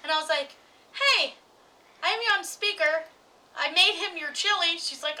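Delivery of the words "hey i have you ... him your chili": high, louder retold phone greeting; raised voice for a speakerphone call; speakerphone announcement. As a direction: call out brightly down the line, setting up a reveal. Calling the mother on speakerphone, retold.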